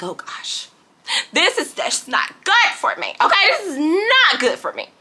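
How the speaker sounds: whispered gasp rising into high nervous laughing; nervous panic. Retold or imitated. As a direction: fret aloud, half laughing. Nervous thought in waiting room.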